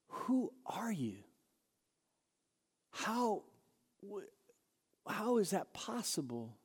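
very soft, halting wonder; hushed bewilderment. Acted. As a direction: grope for words in awed disbelief. Disciples wondering who Jesus is.

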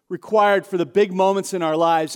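ordinary talk, nothing marked

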